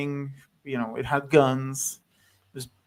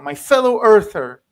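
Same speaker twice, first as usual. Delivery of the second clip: a louder fellow earther said as an emphatic greeting; 4 semitones higher, 9 dB louder than usual. Retold or imitated. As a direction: greet him warmly as a countryman, with emphasis. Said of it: "which is, like".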